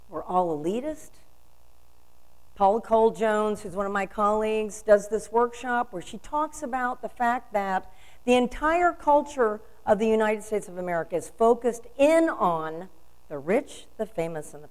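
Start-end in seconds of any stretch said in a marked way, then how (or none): none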